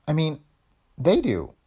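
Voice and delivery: high, anxious correction and question; rattled, high-pitched questioning; gasped alarm. A narrator's reading. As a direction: stammer on in shock, pitch climbing. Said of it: "Kathleen gasped".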